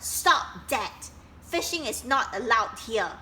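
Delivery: loud stern order; bossy scolding. Acted. Duck forbidding Kingfisher to fish, loud. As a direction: lay down the rule.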